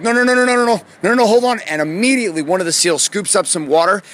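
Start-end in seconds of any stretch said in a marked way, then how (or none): 0.0-1.6 high, rushed string of protests; panicky, whining refusal; whiny panicked victim. Retold or imitated. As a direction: plead like a whiny little victim, higher pitched. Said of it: "this whiny little victim".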